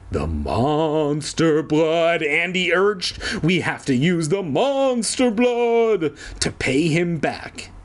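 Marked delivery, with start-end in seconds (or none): 0.1-2.2 slow spooky creature-feature voice; ominous horror voice. Acted. Andy naming the monster blood, slow spooky trailer voice. Ominous reading of title phrase. 3.4-7.7 slow spooky creature-feature voice; ominous horror voice. Acted. Andy naming the monster blood, slow spooky trailer voice.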